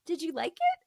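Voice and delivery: high-pitched, coy little question; feigned innocence. Retold or imitated. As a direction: ask it as if you know nothing. Feigning innocence with unknowing audience member.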